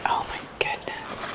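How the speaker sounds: awed hushed whisper. Real, not acted. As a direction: breathe it out in awe. Awed inside a dark church.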